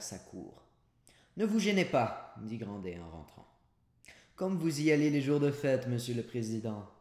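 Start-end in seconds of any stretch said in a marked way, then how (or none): 1.4-2.3 higher, louder ironic jab; mocking host. A narrator's reading. Host mocks the president. 4.4-6.9 higher, louder ironic jab; mocking host. A narrator's reading. Host teases the president.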